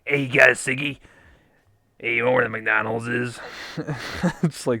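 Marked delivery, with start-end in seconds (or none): 0.0-3.4 imitated raspy bogan beggar; rasp. Shown as a demonstration. Guessing how a bogan asks for a ciggy, raspy voice. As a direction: rasp it out like a rough guy. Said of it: "the rasp to be like".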